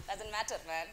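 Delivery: usual speaking voice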